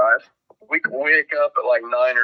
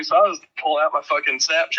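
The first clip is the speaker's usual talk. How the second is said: normal talking voice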